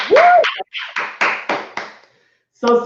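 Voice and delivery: ordinary delivery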